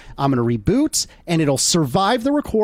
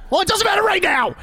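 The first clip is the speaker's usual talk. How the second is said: frustrated shout; 11 semitones higher than usual. Real, not acted. Flustered host snaps back.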